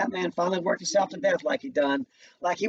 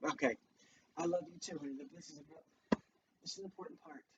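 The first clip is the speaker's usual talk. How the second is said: much softer, tender murmur to a little one; 15 dB quieter than usual. Real, not acted. Reader reassures child mid-reading.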